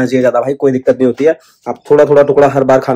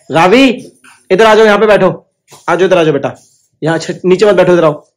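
louder, higher call to the little one; 6 semitones higher, 3 dB louder than usual. Real, not acted. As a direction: call her over, bright and coaxing. Calling baby to come over. (बच्ची को पुकारना)

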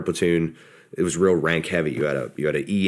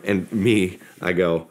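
ordinary delivery

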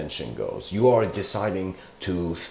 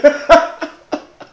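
high, loud burst of laughter; 11 dB louder than usual. Real, not acted. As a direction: laugh out at your own silly ending. Laughs at the happy ending.

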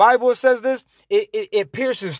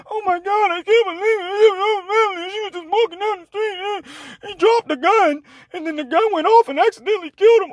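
very high, sobbing whine; mock a hysterical liberal in tears; mocking crybaby voice; 12 semitones higher than usual. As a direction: falsetto boo-hoo impression, crying the words. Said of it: "That's what you sound like".

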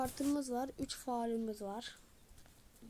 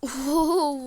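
impressed exclamation; 13 dB louder than usual. Real, not acted. Impressed by a player's move.

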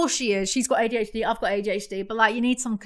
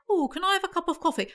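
high, quick, exaggerated British accent; mock British accent; 7 semitones higher, 4 dB quieter, faster than usual. Mocking Americans' bad English accents, exaggerated British voice. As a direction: overdo a dainty British accent. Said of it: "it's not like".

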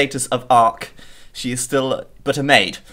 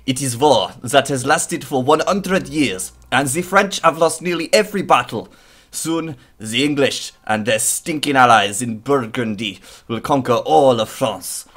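hammed-up French accent, dramatic narration; an intro narrator with a put-on French accent. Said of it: "how French was that accent?".

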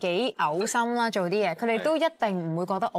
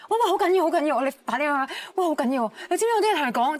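urgent alarm; 6 semitones higher, 4 dB louder, faster than usual. Retold or imitated. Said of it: "見唔到面我就".

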